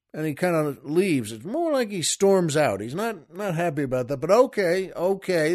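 ordinary delivery

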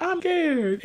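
put-on high, small scared little girl; scared kid. Brianna telling Bernie she is scared, high and small. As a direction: say it like a frightened child. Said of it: "Brianna talk about".

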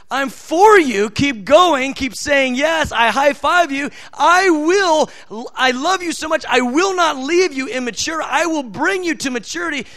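God speaking, fired up with delight; God cheering on. Acted. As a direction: cheer the weak one on like a proud father. Voicing God encouraging the weak.

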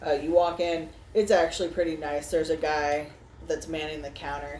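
ordinary talk, nothing marked

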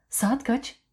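a sudden high shouted question; shouting. A narrator's reading. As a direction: call the time out sharply. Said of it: "diye soruyordu nedense bağırarak".

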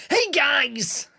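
high, loud geeky caricature; exaggerated squeal mimicking game-shop regulars; mocking geek voice. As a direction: put on a silly high nerd voice. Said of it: "they all talk like this".

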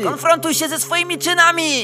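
loud, high-pitched yell; full-voiced, accusing command; commanding shout. A narrator's reading. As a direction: bellow it like a cult leader over chanting. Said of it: "Menel krzyczy".

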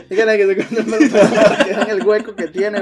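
laughing hard. Real, not acted. Storyteller jokes about showing the cousin's scar, laughing hard. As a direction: push the joke out while cracking up.

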